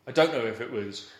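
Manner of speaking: ordinary talk, nothing marked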